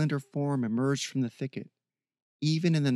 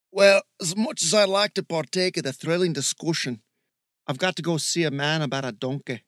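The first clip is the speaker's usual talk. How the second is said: loud, higher mock-Scottish accent; put-on Scottish accent; 5 semitones higher, 6 dB louder than usual. Steve clowning as he excuses himself, put-on Scottish accent. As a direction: lay on a hammy Scottish accent. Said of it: "Michael thought it might be Scottish".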